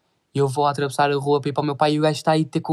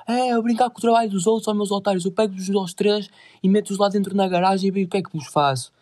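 high-pitched mock threat; threatening stranger; 6 semitones higher than usual. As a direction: imitate the big guy threatening the three. (ameaça imitada, em tom agudo)

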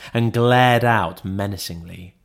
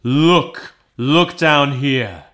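higher, louder urgent ghost; urgent exclamation; 3 semitones higher, 4 dB louder, much slower than usual. A narrator's reading. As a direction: look here, pressed hard on Scrooge. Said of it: "exclaimed the ghost".

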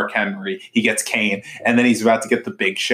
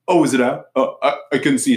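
higher, faux-innocent voice; fake innocent; 4 semitones higher than usual. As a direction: play dumb, sweetly. Faking innocence as [name].

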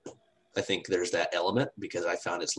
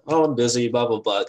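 mocking excuse; 5 semitones higher, 10 dB louder than usual. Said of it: "I post up".

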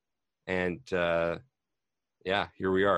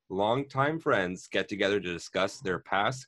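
louder, lively scripted welcome; podcast intro read; 3 semitones higher, 6 dB louder than usual. Acted. Host welcomes listeners to the show, scripted intro read. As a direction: read out a podcast opening with energy.

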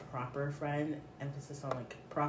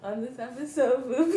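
laughing; 7 semitones higher, 8 dB louder than usual. Real, not acted. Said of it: "laughing through intro".

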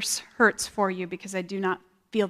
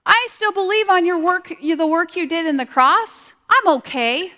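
much higher, louder mock-cheerful reassurance; breezy self-assurance; 8 semitones higher, 4 dB louder than usual. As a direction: brush God off with a chirpy I'm okay. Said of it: "I'm just like".

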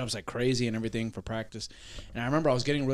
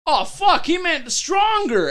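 higher, louder burst of "oh, fuck"; sudden realization; 7 dB louder, much slower than usual. Retold or imitated. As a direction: blurt it out as the penny drops.

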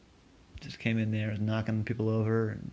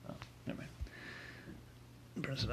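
usual speaking voice

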